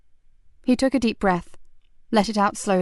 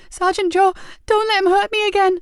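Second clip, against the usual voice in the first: put-on high, loud frightened child begging; terrified plea; 11 semitones higher, 4 dB louder than usual. Boy begging the sergeant to protect him, terrified. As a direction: plead for protection in panic.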